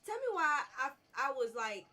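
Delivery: loud, high frustrated exclamation; exasperated outburst. Real, not acted. Gamer exclaims loudly at his own mistake. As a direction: blurt it out at your own blunder.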